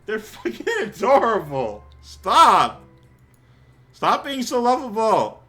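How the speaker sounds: high, loud squeal with a laugh; real delighted outburst; gushing squeal. Real, not acted. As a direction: beg them to stop being so cute. Gushing at cute scene.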